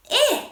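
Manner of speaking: high, disgusted "Eh", a short "ih"; yucky short I. Shown as a demonstration. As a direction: say "Eh" high like the i in igloo, grossed out. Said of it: "Something really yucky".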